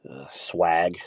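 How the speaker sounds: normal talking voice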